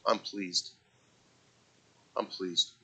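low, hushed, spaced-out approval; God quietly pleased. Acted. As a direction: let quiet satisfaction sink in, softly. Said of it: "God says".

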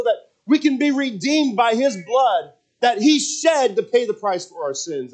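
fervent preaching. Acted. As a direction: preach it out to the whole congregation. Preaching at full force.

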